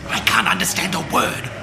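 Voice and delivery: usual speaking voice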